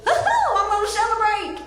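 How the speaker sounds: high, giddy cheer with a laugh; giddy celebration. Acted. Celebrating lost weight with a Big Mac, high and giddy. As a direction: squeal it like a treat is coming. Self-mocking joy over weight loss.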